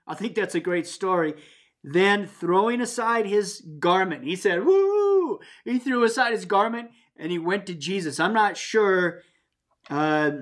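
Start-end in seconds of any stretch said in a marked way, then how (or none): 4.6-5.4 joyful high whoop. Acted. Blind man throws off his garment.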